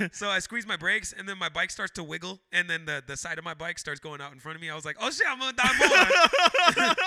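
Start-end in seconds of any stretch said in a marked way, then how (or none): 5.0-7.0 high, loud, drawn-out mock panic; hammed-up terror, much higher and louder. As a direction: wail it like doom is doubling. Escalating panic retold, laughing.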